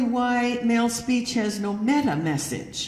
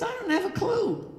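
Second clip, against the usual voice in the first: helpless husband; 12 semitones higher than usual. Retold or imitated.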